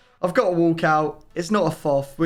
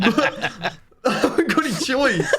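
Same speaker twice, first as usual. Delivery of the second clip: higher, laughing through the words; 5 semitones higher than usual. Real, not acted. To his co-host, repeating the forced pick, laughing. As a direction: crack up as you repeat it.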